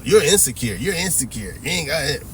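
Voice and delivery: sing-song imitation of scolding women; sarcastic mimicking accusation; mock taunt. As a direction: parody the dismissive jab. Mocking women's favorite insult.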